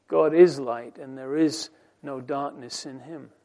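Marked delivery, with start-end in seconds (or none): none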